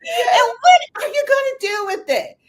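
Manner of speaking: high, loud demand; loud challenge. Real, not acted. Challenging listeners to act on knowledge, loud. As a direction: call the listeners out. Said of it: "challenging the listeners".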